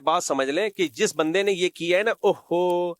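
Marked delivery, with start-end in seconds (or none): 2.1-2.9 put-on sigh of regret; mock a man's theatrical "oh no"; mock dismay. Preacher mimicking the man in the clip, mock dismay. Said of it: "یہ اوفو کر کے acting".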